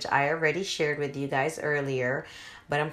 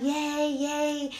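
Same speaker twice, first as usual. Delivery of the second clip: high little cheers; proud parent cheer; 10 semitones higher than usual. Retold or imitated. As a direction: cheer on a toddler's first steps. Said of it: "and we're like".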